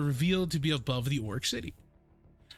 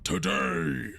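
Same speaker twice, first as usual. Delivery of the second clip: lightly voiced arena announcer, slowing at the name; orc announcer; 4 semitones higher, slower than usual. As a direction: build up the name like a ring announcer. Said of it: "he raises his hands".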